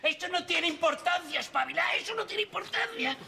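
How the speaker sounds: repite alto y con sorna; búrlate de lo que dijo tu madre; remedo burlón. Acted. (remedando con sorna, más agudo)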